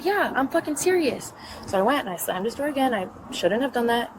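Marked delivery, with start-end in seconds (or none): 0.0-1.3 high-pitched insistent comeback; defiant, pitched up, not shouted; retold defiance. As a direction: quote your own heated retort. Retold angry retort.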